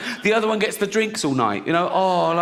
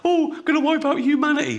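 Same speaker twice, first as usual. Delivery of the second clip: mock panic; 10 semitones higher than usual. Mocking panicked media.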